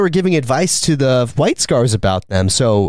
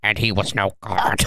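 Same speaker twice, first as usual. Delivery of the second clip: mock-solemn ancient warrior, trailing softer and lower; imitating grave warrior; 3 semitones lower, 4 dB quieter than usual. Old warrior putting Inquisition in its place, mock-grave. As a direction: put on a weighty veteran's voice. Voicing Bjorn rebuking the Inquisition.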